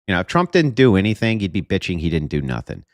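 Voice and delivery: ordinary delivery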